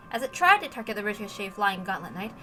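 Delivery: plain reading voice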